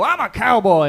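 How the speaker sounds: lightly hammed cowboy brag; mock proud cowboy. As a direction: boast it like a wannabe cowboy. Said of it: "and they go".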